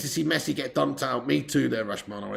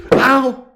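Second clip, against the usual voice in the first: sudden loud, high yelp of pain; real pained yelp; 9 semitones higher, 11 dB louder than usual. Real, not acted. Streamer hurting his foot on that bloody thing, pained yelp. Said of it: "I've just fucking hurt myself".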